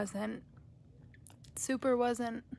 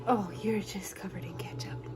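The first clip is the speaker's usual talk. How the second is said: whisper; 6 semitones lower, 7 dB quieter than usual. Real, not acted. Whispering to child.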